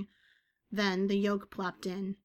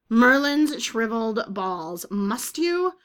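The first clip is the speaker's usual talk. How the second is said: louder, slow then pitching up; exasperated complaint; 2 semitones higher, 9 dB louder, slower than usual. A narrator's reading. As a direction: groan it out, fed up. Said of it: "Agatha complained".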